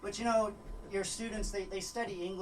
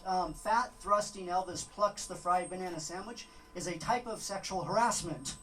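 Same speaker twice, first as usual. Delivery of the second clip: ordinary talk, nothing marked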